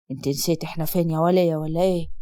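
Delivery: father answering his daughter in a deep voice; stern father. Acted. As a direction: drop low and heavy as the strict father. Father rebukes wife.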